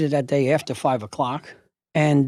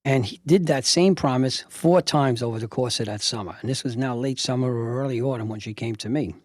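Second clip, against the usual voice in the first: ordinary talk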